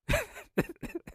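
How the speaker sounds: short, very high laugh. Real, not acted. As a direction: let a squeaky laugh slip. Laughing after his own joke.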